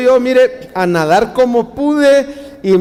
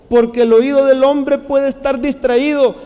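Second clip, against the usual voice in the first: ordinary delivery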